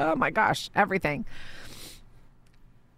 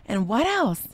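higher, eager keep-going pleas; begging him to keep talking; lightly voiced eager mom; eager listener; 6 semitones higher than usual. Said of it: "I was like".

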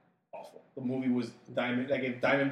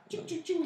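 high imitated gunfire sounds; gunshot noises; 4 dB quieter than usual. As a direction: spit out mock bullet noises.

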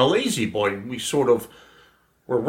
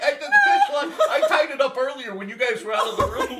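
laughing confession; 11 semitones higher than usual. Real, not acted.

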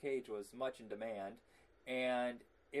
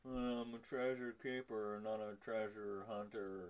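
low, slow, softer detached mumble; lazy low mumbling Nicolas Cage; Nicolas Cage impression; 4 semitones lower, 5 dB quieter, slower than usual. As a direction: play it spaced out and disconnected. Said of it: "Nicolas Cage impression".

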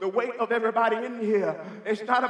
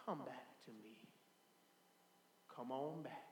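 1 tender plea; 10 semitones lower, 25 dB quieter than usual. Acted. God calling the child back.

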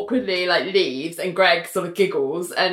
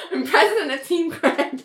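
high voice full of giggles; giggly, high-pitched playful claim; laughing boast; 8 semitones higher than usual. Real, not acted. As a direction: declare it proudly through laughter. Joking fight over Greg, laughing.

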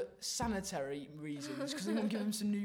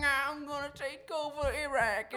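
high, slow, drawn-out mock politician; George Bush; 5 semitones higher than usual. Retelling the war to his mates, mock George Bush. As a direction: put on a caricature of a president. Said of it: "old George Bush came along".

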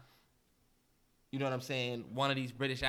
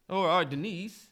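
ordinary talk

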